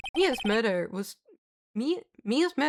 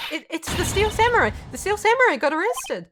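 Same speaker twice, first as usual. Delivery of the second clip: high, louder breathless announcement; urgent, pitched-up blurt; excited news; 8 semitones higher, 3 dB louder than usual. Acted. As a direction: rush out the shocking news. Maya bursts in with news.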